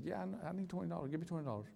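fast insistent repeat when his wife questions him. Retold or imitated. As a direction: push it through, won't take no. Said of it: "You need twenty dollars?".

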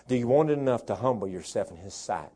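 ordinary talk

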